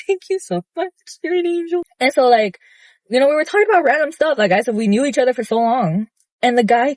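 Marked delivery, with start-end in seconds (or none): 0.1-1.8 gushing thanks. Retold or imitated. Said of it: "And I was like".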